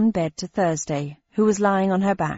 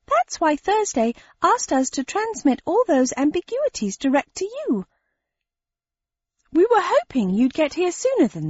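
much higher, odd creature voice; high shape-changer voice; 9 semitones higher than usual. Acted. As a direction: pitch it up as the shifter.

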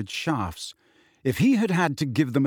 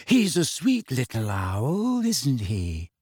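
put-on slow, singsong dreamy girl; languid, faraway musing; slower than usual. A narrator's reading. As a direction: let it drift slowly, falling at the end. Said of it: "a dreamy voice".

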